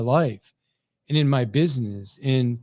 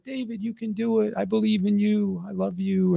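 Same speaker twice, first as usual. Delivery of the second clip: supportive wife; 5 semitones higher than usual. Retold or imitated. Wife encouraging a panicking husband over the phone, tender. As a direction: imitate a gently reassuring wife, pitched up. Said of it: "my wife's always super supportive".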